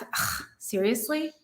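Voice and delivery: fed-up mock huff, then a soft grumble; exasperated huff at a partner; huffy sigh. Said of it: "you do huffy breath".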